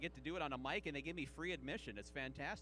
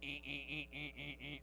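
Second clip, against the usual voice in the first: beeping dial-up noise, lower and softer; dial-up tone imitation; 4 semitones lower, 6 dB quieter, much faster than usual. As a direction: beep like an old dial-up modem. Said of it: "dial tone boot up sound".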